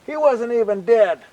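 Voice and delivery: high, loud indignant outcry; really mad. Acted. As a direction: fume at having been tricked. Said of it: "gets really mad".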